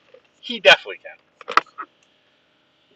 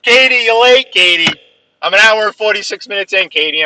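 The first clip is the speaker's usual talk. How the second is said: mock scolding; 7 semitones higher, 5 dB louder than usual. Real, not acted. Teasing late friend on stream.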